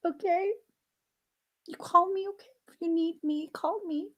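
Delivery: high, soft humoring voice to a small son; humoring a child. Retold or imitated. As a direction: play along sweetly, way up high. Mom humoring her small son.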